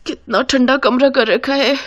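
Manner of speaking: freezing bride, shaking breaths round the words; shivering with cold. Acted. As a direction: grumble through acted shivers. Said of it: "कांपते हुए उस कमरे से बाहर".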